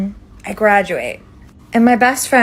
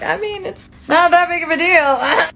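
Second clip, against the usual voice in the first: breezy dismissive; 10 semitones higher than usual. Retold or imitated. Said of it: "I was just like".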